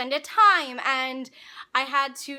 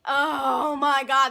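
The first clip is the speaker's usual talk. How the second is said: exasperated; 5 dB louder, much slower than usual. Retold or imitated. Exasperated about the professor.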